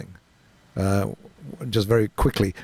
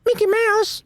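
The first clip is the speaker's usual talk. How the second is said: very high squeaky cartoon mouse; Mickey Mouse voice; slower than usual. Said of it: "can he talk like Porky the Pig?".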